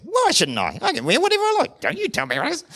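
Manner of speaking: high, fast, indignant comeback; defiant retort. Retold or imitated. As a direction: snap back at a scold, insisting on rights. Mock defiant churchgoer.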